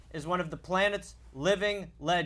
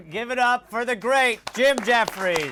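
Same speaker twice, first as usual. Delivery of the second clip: slow, drawn-out stage introduction; big, stretched-out host introduction; guest announcement; 3 semitones higher, 4 dB louder, slower than usual. Acted. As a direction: announce the star guest to applause.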